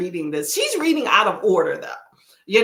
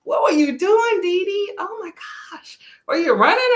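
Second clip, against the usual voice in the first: surprised shout; 11 semitones higher, 4 dB louder than usual. Real, not acted. Greets viewer arriving in chat.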